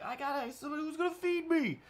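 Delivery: high whiny put-on voice; mock needy voice. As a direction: whine like a helpless dude. Mocking needy people.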